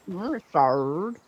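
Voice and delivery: playful pirate, rising and drawn out. Pretend. Answering a friend's request on stream, mock pirate voice. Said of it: "Say shard in a pirate voice".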